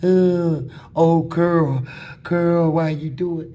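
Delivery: loud, high mock sobbing wail; hammed-up crying inmate. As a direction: wail it like a grown man crying for his girl. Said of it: "he be crying every night".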